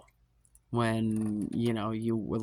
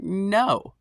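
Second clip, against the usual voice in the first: higher, louder flat refusal; firm no; 9 semitones higher, 6 dB louder than usual. Retold or imitated. Refusing his renewed offer, loud firm no. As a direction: shut the offer down. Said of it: "And I'm like".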